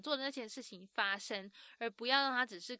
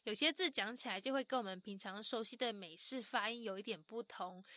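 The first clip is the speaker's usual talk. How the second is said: ordinary talk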